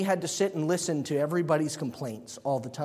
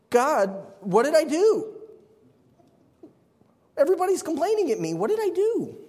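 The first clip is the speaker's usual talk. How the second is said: higher, pleading, exasperated cry; overwhelmed Moses pleading, pitched up; 12 semitones higher, 5 dB louder than usual. Retold or imitated. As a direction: whine up at God in disbelief. Moses paraphrased, pleading to God.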